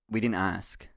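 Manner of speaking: low, dry and flat, no interest at all; deadpan. Retold or imitated. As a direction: kill the joke with a dead-flat brush-off. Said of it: "he deadpans me".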